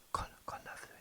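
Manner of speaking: whispered demo; breathy whisper, no voice. Shown as a demonstration. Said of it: "ささやく？".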